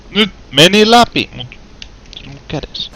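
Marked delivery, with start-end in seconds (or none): 0.1-1.3 higher, louder, stretched-out relief; real gamer triumph; triumphant cry. Real, not acted. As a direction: celebrate finally getting through. Finally succeeds.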